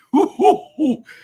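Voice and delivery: sudden high cackle; high burst of laughter. Real, not acted. Cackling at the contestant on screen.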